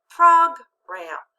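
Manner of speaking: "Program" with a loud, drawn-out first syllable and short-vowel PROG; accent on first syllable. Shown as a demonstration. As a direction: punch PROG in "Program", then drop on "ram". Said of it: "put it together with the accent".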